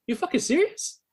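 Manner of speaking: can't believe what he just heard; shocked disbelief. Retold or imitated. Bandmate says he's not a cab driver, stunned disbelief. Reacting to insulting remark.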